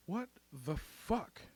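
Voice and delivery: soft, slow, spaced-out words; hushed, drawn-out astonishment; stunned disbelief. Acted. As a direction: think it aloud, quietly dumbfounded. Stunned private thought at the news.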